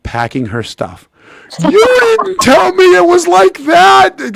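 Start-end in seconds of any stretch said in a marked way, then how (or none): 1.6-4.4 high, loud, drawn-out wail; tearful mock wail. Girlfriend in tears after her first hockey game, wailing. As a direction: imitate an upset girlfriend crying out in accusation. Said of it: "She's full tears".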